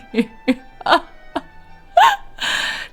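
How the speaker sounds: high, wheezy giggling fit; laughing. Real, not acted. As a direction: giggle until it tails off. Laughs after no good deed.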